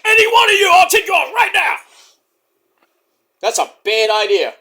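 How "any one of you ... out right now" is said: much higher, louder mock yell; hammed-up call-out from the ring; imitating a challenge. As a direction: bark out a challenge to the whole group. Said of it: "Carl Anderson's like".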